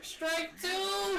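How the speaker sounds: high hammed-up yell; mock shout. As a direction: shout it out like a game move.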